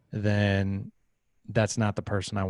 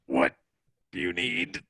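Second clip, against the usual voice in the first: mock exasperation; 2 semitones lower than usual. Tired dad asking his six-year-old, mock exasperated strain. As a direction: play a dad straining for patience.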